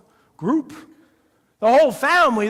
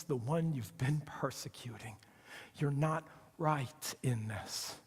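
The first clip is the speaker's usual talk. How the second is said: low and soft, sinking to a whisper; Jesus speaking softly to Saul in his blindness; hushed voice of Jesus; 6 semitones lower, 13 dB quieter than usual. Acted. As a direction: call him gently, then breathe the rebuke.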